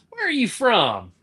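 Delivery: high-pitched mock Southern questioning; nosy Southerner. Southern local to a newcomer, high mock curiosity. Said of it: "people from the South here will be like".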